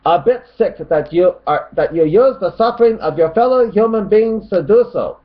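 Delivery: high, loud put-on scolding; mock commenter voice. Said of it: "Tony Stokes says".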